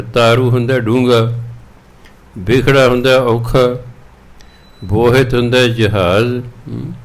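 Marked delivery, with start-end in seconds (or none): none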